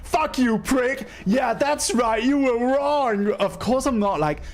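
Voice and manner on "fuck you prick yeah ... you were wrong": high-pitched triumphant cursing; gloating mock rant. Passenger inwardly tells off the man, gloating. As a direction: crow over his defeat, pitched up.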